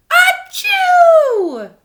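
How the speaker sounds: loud high sneeze; big sneeze. Acted. Elephant Gerald sneezing at Piggy, huge sneeze. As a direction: let the sneeze explode.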